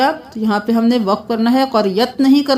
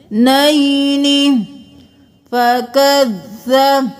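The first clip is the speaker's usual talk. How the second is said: slow melodic tajweed chant, drawn-out vowels; Quran recitation; 4 semitones higher, much slower than usual. Shown as a demonstration. Teacher chanting the verse for students, slow melodic recitation. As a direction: intone each word slowly like a reciter.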